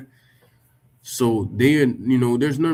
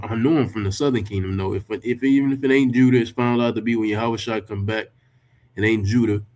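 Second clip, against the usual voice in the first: ordinary talk, nothing marked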